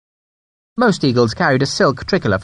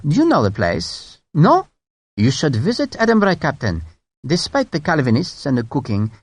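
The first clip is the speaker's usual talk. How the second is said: higher voice, a light Scottish lilt; silky and courteous, a touch Scottish; mild courteous brigadier; 4 semitones higher than usual. Acted. The French brigadier charming Sharp with memories of Edinburgh, mild and courteous. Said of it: "His tone was strangely mild, his intonation courteous, and his English touched with a light Scottish accent".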